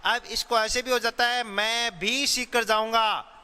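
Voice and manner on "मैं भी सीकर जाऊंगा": मैं भी said slowly, भी stressed. Shown as a demonstration. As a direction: put the weight on भी. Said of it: "मतलब कोई और भी जा रहा है". (भी पर ज़ोर, धीरे से)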